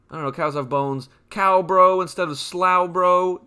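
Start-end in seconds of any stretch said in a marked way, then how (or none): 2.4-3.5 "Slowbro" said as SLAU-bro; joking mispronunciation. Pretend. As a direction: rhyme the first syllable of "Slowbro" with cow. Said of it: "That's how that Pokemon's pronounced".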